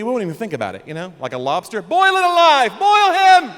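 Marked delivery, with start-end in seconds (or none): 1.8-3.5 high, loud, gleeful yell; bloodthirsty shout. Retold or imitated. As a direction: cheer like a mob at an execution. Mock crowd cheering cruelty.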